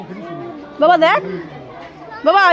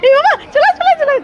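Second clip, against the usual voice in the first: calling out; 10 semitones higher than usual. Real, not acted. Calling child away from cows.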